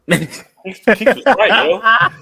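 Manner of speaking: slow words broken by laughter; laughing. Real, not acted. Host conceding the sister was right, laughing. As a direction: laugh through the admission.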